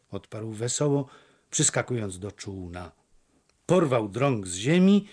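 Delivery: usual speaking voice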